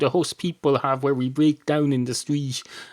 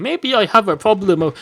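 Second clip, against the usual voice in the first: higher and louder, worked up; louder realization; 7 semitones higher, 8 dB louder than usual. Acted. Cat realizing its beer problem, higher and louder. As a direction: blurt it out as a sudden realization.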